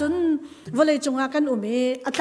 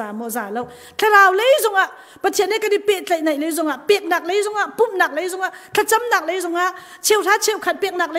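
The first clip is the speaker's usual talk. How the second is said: louder, higher fervent preaching; rising preacher's cry, repeating each phrase; preaching at full force; 5 semitones higher, 4 dB louder than usual. Acted. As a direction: drive the point home to the congregation. Preacher builds to a climax.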